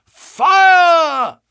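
high, full-throated alarm cry of fire; group yell of fire. Acted. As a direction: yell it out to flush someone from hiding. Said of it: "I will trouble you once again".

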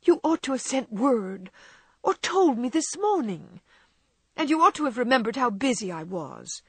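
high, peevish complaint; petulant. A narrator's reading. Wife petulantly scolding her husband for not warning her. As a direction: pout and scold him. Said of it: "continued Meg petulantly".

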